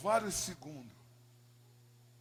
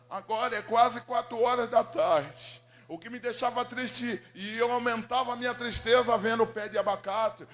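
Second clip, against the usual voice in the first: loud, raised testimony voice; impassioned preaching; 4 semitones higher, 9 dB louder than usual. Retold or imitated. Preacher recounting the neglected home to the congregation, impassioned. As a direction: preach it loudly, driving through the list.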